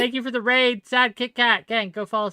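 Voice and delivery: cheerful stream shoutout to the raiders; excited shoutout. Real, not acted. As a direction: hype up the raid thanks. Streamer thanking a Twitch raid.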